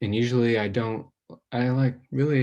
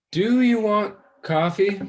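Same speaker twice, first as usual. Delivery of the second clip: forced bright offer; 10 semitones higher, 4 dB louder than usual. Acted. Deflecting again with forced brightness.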